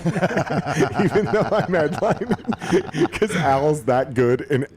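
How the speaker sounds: slow words broken by real laughter; laughing through the joke. Real, not acted. Joking about playing first.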